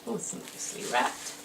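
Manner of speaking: hushed, voiceless unwrapping murmur; whispering. Real, not acted. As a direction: whisper as if not to break the moment. Quietly unwrapping on camera.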